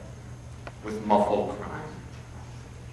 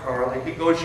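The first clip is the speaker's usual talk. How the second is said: ordinary delivery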